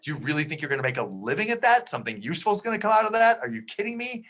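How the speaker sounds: rising, speeding, incredulous rant; exasperated dad climbing into disbelief; mock angry dad. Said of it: "And he's angry".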